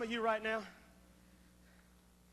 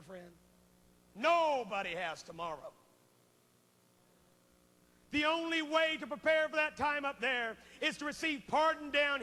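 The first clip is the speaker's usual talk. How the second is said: loud revival preacher, surging; preaching at full force; 6 semitones higher, 5 dB louder than usual. Acted. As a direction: drive the altar call home like a revival preacher.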